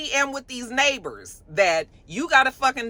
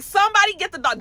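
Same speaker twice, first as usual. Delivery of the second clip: high, loud call for help; calling the neighbors for help, screamed; retold scream; 11 semitones higher, 4 dB louder, faster than usual. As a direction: shout for someone to come get the dog. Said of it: "I'm screaming".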